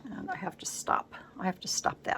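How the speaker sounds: whispered aside, telling herself off; whisper to self. Real, not acted. Crafter whispering to herself after bumping the camera. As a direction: whisper a little self-reproach.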